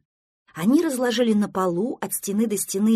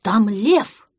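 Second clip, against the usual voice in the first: loud cry of alarm; alarmed shout; 9 dB louder than usual. Acted. Prostodursen spots pretend lion, alarmed shout. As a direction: shout out the danger.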